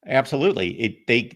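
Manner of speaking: ordinary talk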